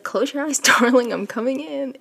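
playful seductive lover, laughing through it; bold flirty come-on. Retold or imitated. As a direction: lay on the seduction, half giggling. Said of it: "So Yor goes fully into it".

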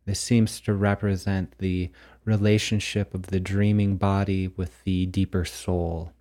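normal talking voice

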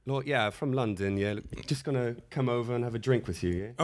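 mock British pickup line; British accent. Shown as a demonstration. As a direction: pour on the London charm. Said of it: "Can we hear the British accent?".

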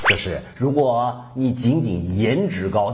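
ordinary talk

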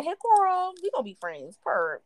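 butter her up with a sugary sing-song; mock sweet suck-up. Sucking up to scary girl.

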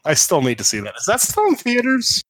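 ordinary talk

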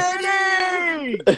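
high, loud happy shout; excited greeting. Real, not acted. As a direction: cheer the name out. Greeting friend joining call.

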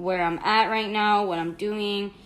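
ordinary talk, nothing marked

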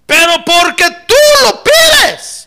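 slow, high, full-voiced shout; declaring shout. Peter agreeing because the Lord asks, slow resolute shout. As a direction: shout it with resolve, stretching each word.

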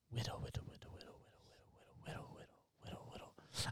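barely audible whispered repetition; hushed muttered sound effect; whispered whittling noise. Pretend. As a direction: whisper it rhythmically as you carve. Miming whittling wood into a saddle.

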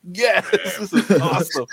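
excited. Retold or imitated. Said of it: "I was like".

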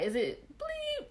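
high, slow, drawn-out question; suspicious guess. Retold or imitated. Asking best friend if it's the same guy, high. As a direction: float the name up in disbelief. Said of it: "And I was like".

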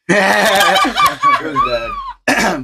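laughing admission. Real, not acted. Soldier confessing army trouble to friends, laughing. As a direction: laugh it out, embarrassed.

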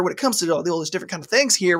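usual speaking voice

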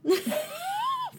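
squealing high laugh. Real, not acted. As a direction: burst out laughing. Big laugh.

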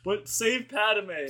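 very slow, drawn-out sarcastic plea; mock earnest. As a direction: stretch it out sarcastically. Mocking Anakin's motive.

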